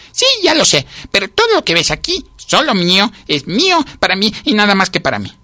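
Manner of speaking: very high, insistent scolding; guard your hoard word by word; possessive outburst. A narrator's reading. Ant jealously guards its food.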